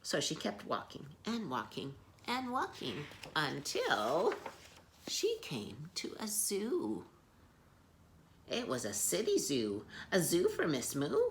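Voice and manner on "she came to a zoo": slow suspense whisper; story whisper. A narrator's reading. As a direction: hush it like a secret. Suspense before reveal.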